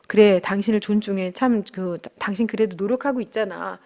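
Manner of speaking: soft reassurance. Retold or imitated. Husband reassuring his tired wife, softly tender. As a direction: ease her heart, almost murmured. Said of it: "이런 식으로 좀 이렇게 말을 참 해주시면".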